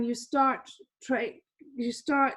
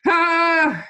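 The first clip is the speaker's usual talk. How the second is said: loud, high, drawn-out "ah"; hammed-up wail of panic; mock distraught scream; 5 semitones higher, 9 dB louder than usual. Teacher mocking a trader panicking over a loss, exaggerated cry. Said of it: "having an epileptic fit over it".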